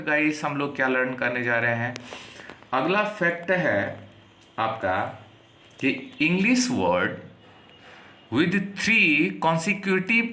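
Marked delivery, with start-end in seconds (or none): 6.2-10.3 very slow, word by word; slow dictation. Shown as a demonstration. Teacher dictating to students, slow and deliberate. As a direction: read it out slowly so students can write.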